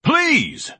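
sharp, high exasperated outburst; exasperated cry. Acted. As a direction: cry out at the end of your patience. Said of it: "Ignatius pounded a paw".

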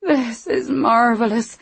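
talking through a mouthful; mouth full. Acted. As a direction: savour it around a bite of egg. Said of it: "her mouth full of egg".

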